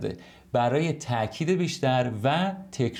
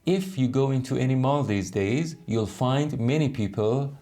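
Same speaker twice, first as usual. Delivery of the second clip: slow clear reading; much slower than usual. Shown as a demonstration. Teacher reading the if-version of the sentence slowly. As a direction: read slowly for learners.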